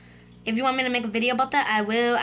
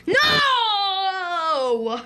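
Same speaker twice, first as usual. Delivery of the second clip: long wailed no, pitched high then falling; drawn-out "no" of dismay; 5 semitones higher, 4 dB louder than usual. Real, not acted. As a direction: cry out in defeat. Fails in the game.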